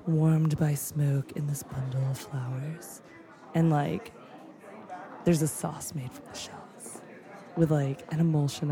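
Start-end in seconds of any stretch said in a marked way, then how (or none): 0.8-7.0 slow, much softer whisper; hushed whisper. Real, not acted. Describing a tasting menu, slow reverent whisper. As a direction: whisper it like a secret delight.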